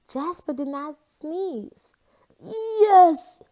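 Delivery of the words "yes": high, loud triumphant yell; loud cheering yes; hollering. Acted. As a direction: shout it out in victory. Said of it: "he hollered".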